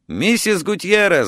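high-pitched, half-restrained yell; narrated yell, pitched well up. A narrator's reading. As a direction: call out urgently down the phone. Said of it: "заорал я".

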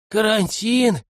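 mildly alarmed query; wary. A narrator's reading. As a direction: ask it as if suddenly on guard. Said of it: "насторожился Матвей".

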